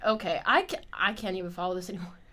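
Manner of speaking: ordinary talk, nothing marked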